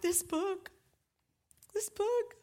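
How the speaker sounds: high-pitched tearful husband impression; mock weeping. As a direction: imitate a man choked up with tears. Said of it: "he came out of the bedroom weeping".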